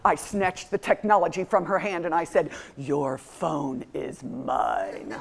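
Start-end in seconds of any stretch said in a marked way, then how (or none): 2.8-5.1 very slow, low, soft menace; menacing verdict. Retold or imitated. Mom seizing the phone from her daughter, menacing. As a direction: pronounce sentence slowly in a low voice.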